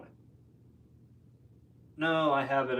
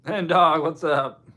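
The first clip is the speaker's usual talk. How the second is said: excited greeting; 5 semitones higher, 7 dB louder, slower than usual. Real, not acted. Greeting a newly arrived viewer.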